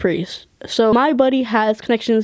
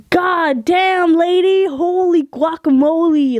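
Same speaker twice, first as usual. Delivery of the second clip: appalled, pitched-up outburst; mock shock; 7 semitones higher than usual. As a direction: react as if blown back by her. Reacting to being yelled at.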